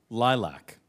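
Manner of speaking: normal talking voice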